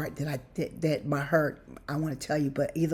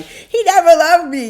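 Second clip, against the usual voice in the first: much higher and louder, a hammed-up lament; mock heartbroken wail; 13 semitones higher, 14 dB louder than usual. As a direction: wail it like a jilted lover, over the top. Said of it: "my heart will be like".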